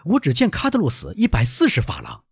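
high, agitated exclamation; loud protest. Acted. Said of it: "当戴斯大声说".

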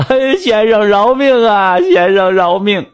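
slow, high desperate begging; begging for mercy. Acted. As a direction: drag out the plea like kneeling servants. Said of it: "连声喊道".

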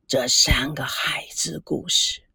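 whispered, wistful sigh to himself; soft sighing whisper. A narrator's reading. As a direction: breathe it out softly, remembering the past. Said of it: "赵念祖轻声的叹息". (轻声叹息地低语)

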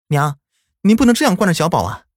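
high, fast indignant protest; protesting mother. Acted. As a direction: object quickly to grandma.

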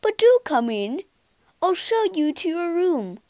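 lightly voiced polite poodle; a polite butler dog. A narrator's reading. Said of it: "the poodle speaking".

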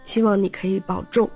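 ordinary talk, nothing marked

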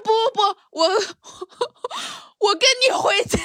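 a hammed-up desperate tag-along; mock pleading friend. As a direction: squeal it like a friend who won't let go. Mimics friend insisting on following.

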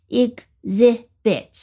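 "exhibit" slowly in three separate pieces, ex-hi-bit; syllable by syllable. Shown as a demonstration. Teacher introducing a new word, "exhibit" syllable by syllable.

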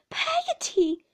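high, loud alarmed call; frightened child's cry. A narrator's reading. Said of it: "quite frightened".

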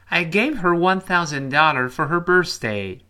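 teacher's emphatic read-along delivery; model reading for repetition. Shown as a demonstration. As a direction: read it out clearly for students to repeat. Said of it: "好，再来一遍".

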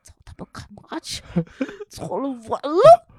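mock rant rising high, fast and laughing; furious thoughts breaking into laughter; inner fury. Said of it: "我心说".

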